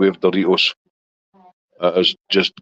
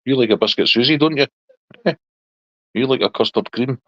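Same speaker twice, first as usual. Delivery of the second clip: cooing at the dog; 5 semitones higher than usual. Real, not acted. Coos to dog about biscuits.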